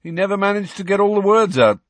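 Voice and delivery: excited twin's boast; gleeful Fred. Acted. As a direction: gush with mischievous glee.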